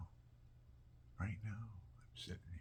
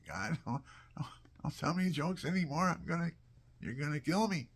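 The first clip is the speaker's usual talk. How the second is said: louder, higher comic begging; mock pleading; 6 semitones higher, 7 dB louder than usual. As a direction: beg as if the jokes are too much. Acted plea to joking God.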